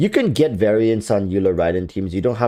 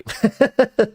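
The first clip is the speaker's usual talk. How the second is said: awkward laugh; 12 semitones higher than usual. Real, not acted. Awkward laugh after aside.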